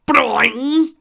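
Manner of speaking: high, loud cartoon boing; spring up like a startled toon. Cartoon surprise noise.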